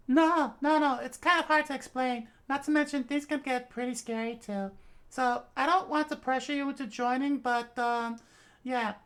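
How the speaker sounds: high voice, hesitant; shy girl. Acted. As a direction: explain without pressuring. Fuko explaining.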